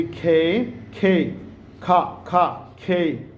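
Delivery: "खे" and "खा" with a puff of air; aspirated K. Shown as a demonstration. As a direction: separate drilled "खे", "खा", breath after each k. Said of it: "आपने उसको खे बोलना है".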